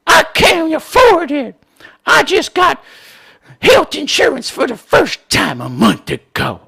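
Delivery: hammed-up tearful high whine; mock whiny AOC. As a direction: whimper it like a pitiful victim.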